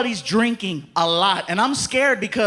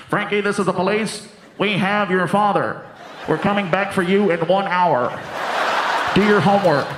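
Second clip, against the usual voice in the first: dad on the squad car microphone; megaphone cop voice; 3 semitones lower, 3 dB louder than usual. Retold or imitated. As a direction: announce like a cop on the loudspeaker. Speaks through police megaphone.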